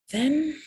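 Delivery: a high, loud, drawn-out then, held; dramatic pause. Real, not acted. Telling viewers her story, building suspense on Then.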